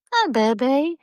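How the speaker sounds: high cooing greeting. Real, not acted. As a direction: coo hello to the kitty. Greeting cat.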